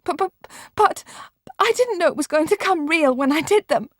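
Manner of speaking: put-on a girl stammering through tears. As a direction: blurt it out crying. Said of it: "said Marianne in tears".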